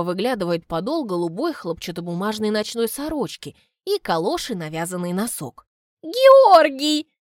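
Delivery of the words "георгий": loud, high fake astonishment; feigned surprise. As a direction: call his name in staged surprise. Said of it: "восклицает она, мастерски изображая удивление".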